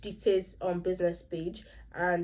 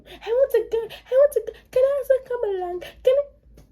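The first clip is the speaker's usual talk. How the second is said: put-on very high childlike begging; shouting; 5 dB louder than usual. Ife begs to join the adults going out, high mock shouting. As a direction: mimic a youngster clamouring to tag along, pitch way up. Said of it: "Ife just started shouting".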